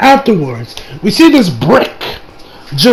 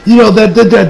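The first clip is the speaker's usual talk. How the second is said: usual speaking voice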